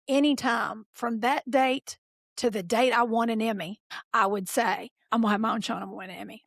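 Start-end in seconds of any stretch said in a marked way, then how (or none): 5.1-6.4 rapid-fire, at everyday pitch; quick, certain retold announcement; matter-of-fact declaration. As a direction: rattle it off as settled fact. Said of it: "Just declare it".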